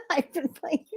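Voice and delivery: high, giggly words straight out of a laugh. Real, not acted. Host confesses to playing virtual reality, laughing. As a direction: talk through the tail of a giggle.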